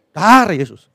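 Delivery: loud drawn-out mock exclamation; mimicked pious exclamation. Preacher mimics his over-spiritual friend, loud exclamation.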